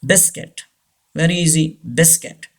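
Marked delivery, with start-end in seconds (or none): 0.0-0.6 BISCUIT as BIS-kit; silent U. Shown as a demonstration. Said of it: "U is silent here". 2.0-2.5 BISCUIT as BIS-kit; silent U. Shown as a demonstration. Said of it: "U is silent here".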